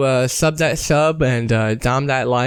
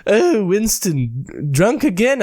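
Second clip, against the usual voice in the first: high, slow prim British woman; scolding lady voice; 7 semitones higher, slower than usual. Retold or imitated. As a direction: tut at him disapprovingly. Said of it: "she's like".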